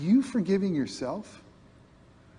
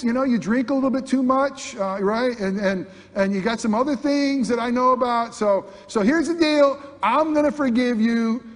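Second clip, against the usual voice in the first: higher and louder, a pushy deal-maker God; mock bargaining God; 5 semitones higher, 4 dB louder than usual. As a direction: lay out the terms like a salesman closing a contract. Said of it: "He doesn't say this".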